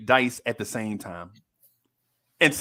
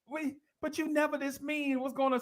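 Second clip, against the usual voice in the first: put-on much higher and softer, timid child voice; mock little kid; 11 semitones higher, 7 dB quieter than usual. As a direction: ask it like a wide-eyed small boy. Kid questions the teacher.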